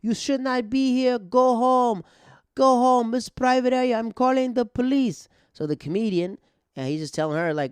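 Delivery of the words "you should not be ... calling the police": high, loud imitation of a drunk heckler; mock shrieking complaint, pitched way up. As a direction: yell it like an irate woman heckling a show. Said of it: "She's heckling a comedian".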